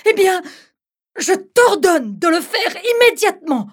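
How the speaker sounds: high, loud stern command; commanding bride. Acted. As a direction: snap back the order. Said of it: "rétorqua-t-elle".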